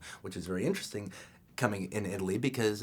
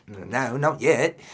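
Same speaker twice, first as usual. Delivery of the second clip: put-on slow drawled Australian accent; slow Aussie reply, drawn out; 3 semitones higher, 9 dB louder, much slower than usual. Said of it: "Your accent's pretty good".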